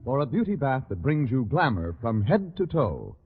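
ordinary talk

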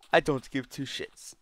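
quiet, put-on comic aside; comedy skit character. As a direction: quote the skit under your voice. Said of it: "heard that from a fucking comedy skit".

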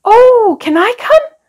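high eager dragon asking. A narrator's reading. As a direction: beg eagerly like a keen child. Dragon begs to join tea.

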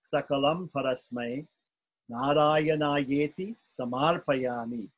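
2 slow, careful chant, syllable by syllable; 3 spell out each syllable slowly for students to copy; 1 slow model recitation. Shown as a demonstration. 4 teacher models the verse slowly before students try, careful chant. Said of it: "Who wants to try?".

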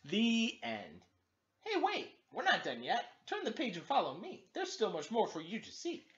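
high, lively call to the reader; cheery book voice. Acted. Gilbert calling young readers to turn the page, high and cheery. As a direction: beckon the kids to keep reading.

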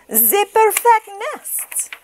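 very high, slow duck voice; high slow duck admiration; delighted duck. Acted. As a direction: coo over the nest as the duck. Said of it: "she cried".

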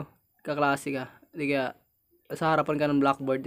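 ordinary delivery

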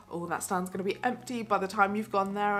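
usual speaking voice

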